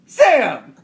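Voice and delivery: high, loud puzzled who; playing mother-in-law on the phone; confused caller. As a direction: shout the question up high. Acting out phone call.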